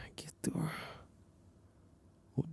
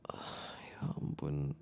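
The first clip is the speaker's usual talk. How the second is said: embarrassed whisper; 9 semitones lower, 8 dB quieter, much slower than usual. Acted. Embarrassed about drunken whining.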